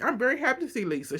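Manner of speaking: a hint of fake-polite Mary, rattled off; mock Mary. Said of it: "She went".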